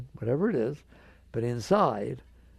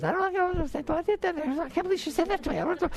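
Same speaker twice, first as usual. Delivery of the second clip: squeaky, much higher cartoon ego fretting; whiny ego voice. Teacher voices the fretting ego to the audience, high squeaky whine. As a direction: play the anxious little ego, pitched way up. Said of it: "and this thing goes".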